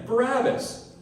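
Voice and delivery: usual speaking voice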